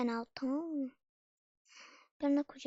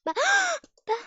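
sudden high gasp of fright; scared gasp; 4 dB louder than usual. Real, not acted. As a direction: gasp as you almost fall.

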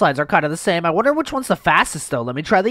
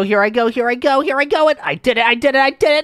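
building excitement; 9 semitones higher, 4 dB louder than usual. Real, not acted. Excited ride chant.

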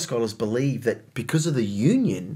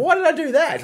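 high puzzled question; baffled, asking herself; puzzled surprise; 4 dB louder than usual. Retold or imitated. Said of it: "she didn't know".

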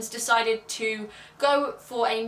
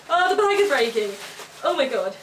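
louder, higher sudden alarm; startled outcry; 3 semitones higher, 5 dB louder than usual. Real, not acted. As her pink sack splits, startled louder cry. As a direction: yelp as the bag rips.

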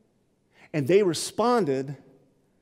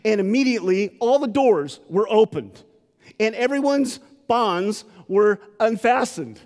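dramatic reading; 6 semitones higher, 6 dB louder than usual. Acted. Preacher reading the doors opening.

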